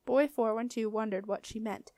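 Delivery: ordinary delivery, nothing marked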